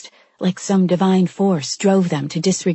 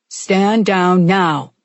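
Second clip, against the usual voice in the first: slow, loud amplified command; loudspeaker voice; 5 dB louder, much slower than usual. A narrator's reading. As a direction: boom it over a loudspeaker. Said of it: "over a loudspeaker".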